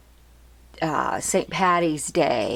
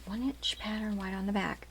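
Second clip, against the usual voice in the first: soft repeated whisper, much quieter; 3 semitones higher, 9 dB quieter than usual. Shown as a demonstration. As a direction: whisper it again as a reminder.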